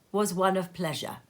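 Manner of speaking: plain reading voice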